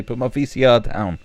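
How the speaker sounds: loud, high-pitched irate householder; mock angry yelling. Householder to a guest taking the player, mock outrage. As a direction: yell at a thief, hammed-up outrage. Same acted quarrel.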